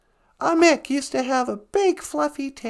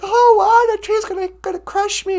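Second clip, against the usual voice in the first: startled beaver; 8 semitones higher, 7 dB louder than usual. Acted. Tree falling toward beaver.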